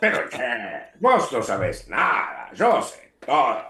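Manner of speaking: Argentine Spanish accent. Shown as a demonstration. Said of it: "can we have a sample of some of that Argentine".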